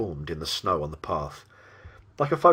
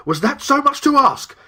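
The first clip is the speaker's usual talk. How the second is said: much higher, louder cry of grievance; exasperated outburst; 9 dB louder than usual. Acted. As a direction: burst out in exasperated protest. Demon's furious thought voiced in narration.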